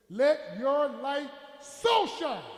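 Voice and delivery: very slow, with "so" punched high and loud; big drawn-out burst on "so"; stress on "so". Shown as a demonstration. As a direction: read it slowly, then hit "so" hard before "shine". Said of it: "I want you to stress the word so".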